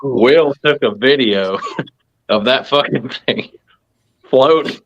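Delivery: talking through real laughter; laughing. Real, not acted. Telling friends about the floating toy, laughing through it. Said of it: "laughing while telling".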